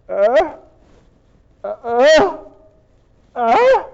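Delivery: questioning "uh?" rising each time; dumbfounded, wordless little noises; baffled grunts. Acted. Mary sits there hearing the angel, grunting in confusion. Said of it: "Mary's gotta be sitting there going".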